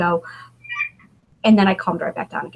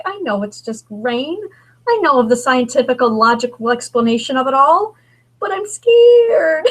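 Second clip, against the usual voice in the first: laughing admission of fear; 5 semitones higher, 4 dB louder than usual. Retold or imitated. Retells her fear to husband.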